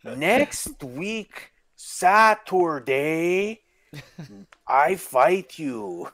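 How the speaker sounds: very slow hammed-up Spanish accent; mock Spanish accent. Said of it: "comedic fucking version of a Spanish, accent".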